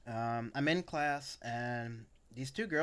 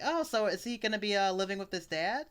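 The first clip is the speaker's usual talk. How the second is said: mocking teacher voice; 8 semitones higher, 4 dB louder than usual.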